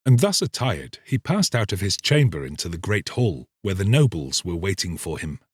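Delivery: ordinary delivery, nothing marked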